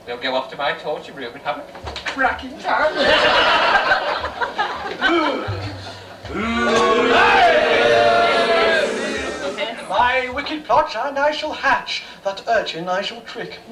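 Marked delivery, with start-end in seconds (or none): 3.0-9.8 long loud villain cackle; loud panto baddie laugh; evil cackle. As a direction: throw back the head and cackle.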